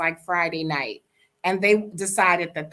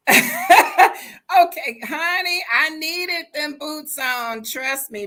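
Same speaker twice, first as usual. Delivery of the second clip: high, loud and laughing through the words; amused big-sister scolding; laughing retort; 8 semitones higher, 5 dB louder, slower than usual. Retold or imitated. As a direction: tease her with a laugh, honey. Speaker quotes her own retort.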